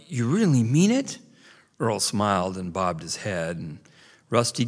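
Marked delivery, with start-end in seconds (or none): 0.1-1.2 put-on high hopeful young beaver; eager, disbelieving joy; delighted kid voice. A narrator's reading. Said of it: "Rusty cried".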